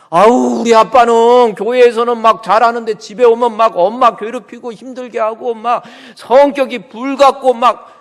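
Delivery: loud, high acted grumbling; exasperated child's complaint. Child complaining about a father who is devout only at church, loud exasperation. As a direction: complain loudly as a fed-up child.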